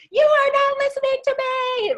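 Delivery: very high, loud outburst; mock furious yell. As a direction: yell it in hammed-up outrage. Acts out confronting the landlord.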